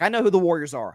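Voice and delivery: usual speaking voice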